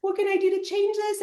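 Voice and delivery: high, mock tearful pleading; old school [name]. Old self sobbing agreement to yelling ex-boyfriend, high mock tearful. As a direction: imitate her weepy, eager-to-please old self. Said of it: "bawling my face off".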